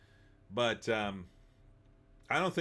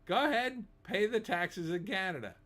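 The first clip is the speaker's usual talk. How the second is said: high sarcastic taunt, pitched well up; mocking dare; 8 semitones higher than usual. Taunting Americans who move to Canada, in a high mocking voice. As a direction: egg them on with a sneering lilt.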